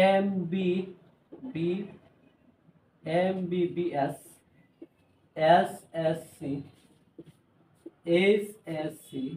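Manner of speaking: slow, letter by letter; slow spelling. Shown as a demonstration. Teacher spelling abbreviations slowly for students. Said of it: "spelling out abbreviations".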